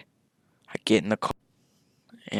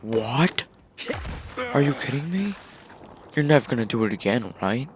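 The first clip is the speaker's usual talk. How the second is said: shocked, rising questions, a touch higher; incredulous kid; 5 semitones higher than usual. Retold or imitated. Kid texting back, shocked that he got high, incredulous rising tone. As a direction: act stunned and disbelieving.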